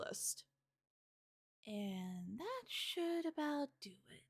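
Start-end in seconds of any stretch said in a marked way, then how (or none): 1.7-4.2 slow, high, soft murmur; soft, unhurried self-talk; talking to herself. Acted. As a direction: say it slowly and quietly to yourself. Lena checking off list to herself.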